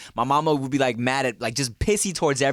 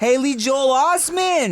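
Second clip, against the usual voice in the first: excited; 10 semitones higher, 4 dB louder, slower than usual. Real, not acted. Excited recognition of actor.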